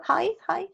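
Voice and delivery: "hi" said high-pitched, as in hello; like a greeting. Shown as a demonstration. Teacher compares the adjective to hello, "hi" pitched high. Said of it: "like when you say hello".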